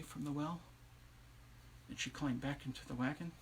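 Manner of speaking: very soft, low narration; quiet, spaced-out storyteller voice; hushed storytelling. A narrator's reading. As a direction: let the tale drop to a hush.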